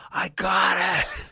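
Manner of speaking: high, slow crying shout; triumphant shout. Retold or imitated. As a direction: yell it out in joy. Triumphant cry on catching pick.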